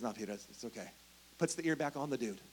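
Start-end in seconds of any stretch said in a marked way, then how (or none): 0.0-0.9 much softer, lower, calming Jesus; gentle reassurance. Retold or imitated. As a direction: soothe Peter quietly. Jesus calms Peter gently.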